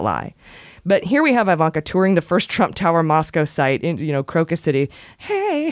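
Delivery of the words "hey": high sung hey; excited shout. Pretend. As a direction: yelp it like a rock chorus. Song shout after Crocus City.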